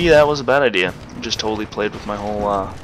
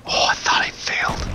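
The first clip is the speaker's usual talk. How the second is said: whispering. Real, not acted. Relieved aside mid-game.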